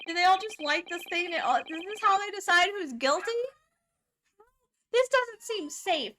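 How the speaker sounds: loud, high-pitched bewilderment; alarmed disbelief. Real, not acted. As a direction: question it in rising alarm. Alarmed at jurors throwing fire.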